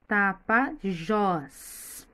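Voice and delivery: "Tapajós" drawn out slowly, syllable by syllable; slow split of "Tapajós", long stressed final "jós". Shown as a demonstration. Teacher showing children the strong syllable, "Tapajós" slowly split. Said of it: "a sílaba pronunciada com mais força".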